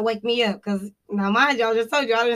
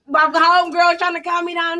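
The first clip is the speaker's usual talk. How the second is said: normal talking voice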